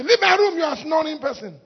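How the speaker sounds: loud, high mock scolding; annoyed outburst. Spouse throwing a snorer out of the room, annoyed outburst. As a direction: burst out as the fed-up spouse.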